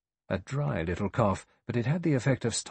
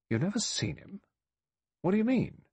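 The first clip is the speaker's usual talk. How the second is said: high-pitched incredulous query; 12 semitones higher than usual. A narrator's reading. General MacArthur challenges Rogers's answer, incredulous. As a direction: question it in high-pitched disbelief.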